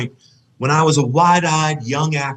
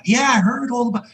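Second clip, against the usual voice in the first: quoting Carson; 9 semitones higher than usual. Retold or imitated.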